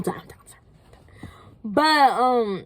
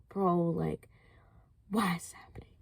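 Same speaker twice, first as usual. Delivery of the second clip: whispered, almost voiceless lament; despairing whisper; 3 semitones lower, 13 dB quieter than usual. Retold or imitated. Driving home after the car died, a hushed whispered lament. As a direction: breathe it out to yourself in despair.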